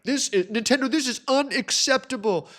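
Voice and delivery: high-pitched clickbait indignation; hammed-up whiny protest; mock outrage. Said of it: "part of the whole clickbait thing".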